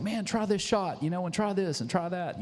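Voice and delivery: high, excited retold sales push; eager offering. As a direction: act out plying a guest with samples. Eagerly pushing coffee samples on Ty.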